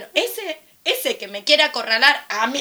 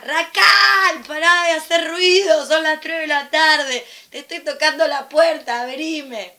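loud, high call to the neighbour; shouting at the noisy neighbour through the window; 5 semitones higher, 7 dB louder, slower than usual. Retold or imitated. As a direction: yell at her to stop the noise. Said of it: "le grito por la ventana".

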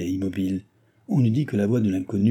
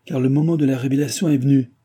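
louder, higher, ringing order; commanding tone; 6 semitones higher, 6 dB louder than usual. A narrator's reading. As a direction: command them to reveal it now. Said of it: "avec le ton du commandement".

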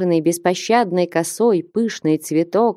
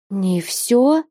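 horrified cry. Acted. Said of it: "с ужасом вскрикнула".

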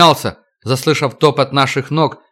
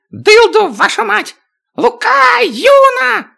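high shrieking dwarf; man down shout; slower than usual. Acted. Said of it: "заверещал он".

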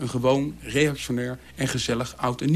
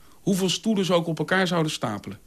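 ordinary delivery

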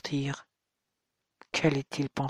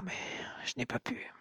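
broken whisper; 3 semitones higher, 15 dB quieter than usual. A narrator's reading. Confessing he couldn't tell.

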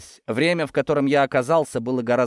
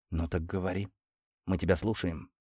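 soft, low coaxing; encouraging; 4 semitones lower, 8 dB quieter than usual. A narrator's reading. Interrogator prompts the broken worker, encouraging. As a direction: invite him gently to talk. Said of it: "поощряюще сказал я".

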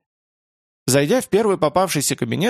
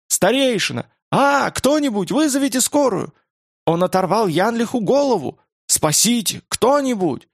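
panicked screaming; 8 semitones higher than usual. Acted. Trapped staff scream for help.

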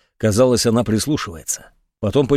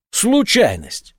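scornful; 7 semitones higher, much slower than usual. A narrator's reading. Major's mocking scornful word.

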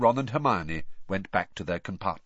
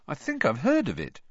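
higher, mildly uncertain; vague; 7 semitones higher than usual. A narrator's reading. Boy unsure about rival school, vague. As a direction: say it as if half remembering. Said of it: "said Ron vaguely".

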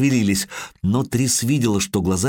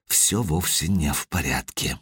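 inner thought; 6 semitones lower, 5 dB quieter than usual. Acted. Мысль Трис про себя.

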